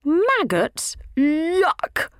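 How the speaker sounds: high, squealing yuck of disgust. A narrator's reading. Flower tot refusing Slugsy's maggoty gift, high disgusted squeal. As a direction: recoil from the maggots.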